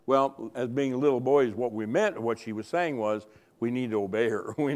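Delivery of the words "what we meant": usual speaking voice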